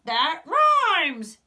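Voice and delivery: loud delighted discovery; a happy shark shouting his find; excited cry. A narrator's reading. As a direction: cry out as it clicks. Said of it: "he cried".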